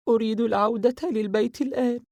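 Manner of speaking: wanting to go home, a touch sulky; sad and restless. A narrator's reading. Sara pleading with her sister to go home, mildly sulky. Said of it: "بخليط من الحزن والتململ".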